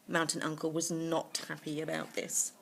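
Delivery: plain reading voice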